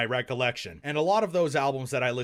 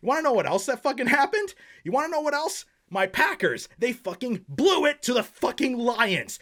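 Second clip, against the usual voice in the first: high, fast indignant rant; angry rant; 6 semitones higher than usual. Acted. Vlogger to viewers about the Packers, indignant high rant. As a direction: vent about the Packers losing. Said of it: "Sorry for that rant earlier".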